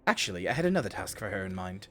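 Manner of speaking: a calm officer making a polite request; NPC voice. Acted. Streamer voicing the commander asking for the report, soft.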